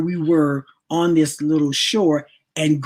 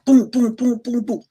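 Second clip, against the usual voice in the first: boom sound effects; 8 semitones higher than usual. Retold or imitated. Sound effect of tumbling fall.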